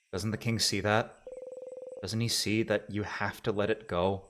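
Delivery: low, soft, drained narration; exhausted hush. Acted. Narrating the aftermath of the scream, soft and spent. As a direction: let every word sag with fatigue.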